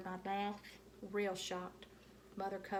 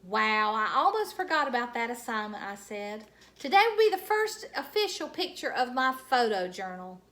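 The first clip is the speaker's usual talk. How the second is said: louder, excited chatter; too loud; 3 semitones higher, 10 dB louder than usual. A narrator's reading. Junie B excitedly remembering her photo assignment. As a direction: blurt it out with delight. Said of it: "you're still talking too loudly".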